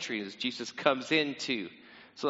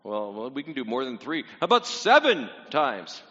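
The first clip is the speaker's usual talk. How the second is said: Peter making a generous offer; 4 semitones lower than usual. Retold or imitated. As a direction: muse it over, then proudly raise the bid. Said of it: "So Peter going".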